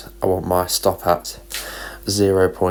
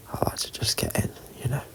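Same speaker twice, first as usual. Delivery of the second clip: whispering; 3 semitones higher, 10 dB quieter, faster than usual. Real, not acted. Whispered restart.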